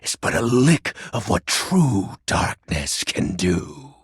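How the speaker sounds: usual speaking voice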